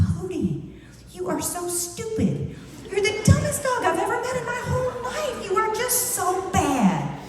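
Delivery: high, slow, gushing dog-cooing voice; petting baby talk. Shown as a demonstration. Owner petting basset hound, insults in sweet baby talk. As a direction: coo insults as if they were sweet praise. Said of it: "I would start petting him and I would go".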